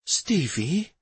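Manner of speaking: high, questioning mild shock; a startled query, rising at the end. A narrator's reading. Wife stepping back from her husband, mildly shocked. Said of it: "stepped back from Stavin in shock".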